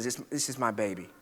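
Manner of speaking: a mild hushed imitation of a sobbing mother; hushed retold sob. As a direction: murmur it like a mother sobbing into the phone. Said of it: "she, again, sobbing".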